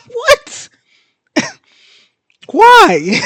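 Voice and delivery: very high, loud squeal with laughter; laughing disbelief. Real, not acted. Reader finding out it's Morgaine, laughing disbelief. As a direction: cry out in baffled, laughing protest.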